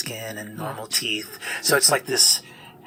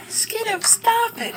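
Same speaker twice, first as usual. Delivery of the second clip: very high squeaky scold; tiny fairy scolding; slower than usual. Acted. Honeysuckle scolding Skinner, very high squeaky. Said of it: "Honeysuckle says".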